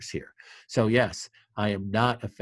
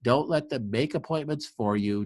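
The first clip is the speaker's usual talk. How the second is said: normal talking voice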